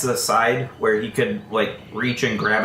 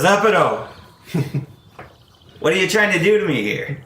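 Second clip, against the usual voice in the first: exasperated guard; 7 semitones higher, faster than usual. Acted. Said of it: "He says".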